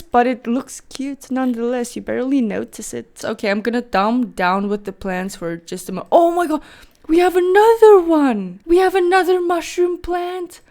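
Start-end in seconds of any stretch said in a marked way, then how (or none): 6.1-10.6 high, louder thrilled squeal of delight; excited discovery. Real, not acted. Vlogger spotting another mushroom plant, excited outburst. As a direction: burst out, pitched way up, overjoyed.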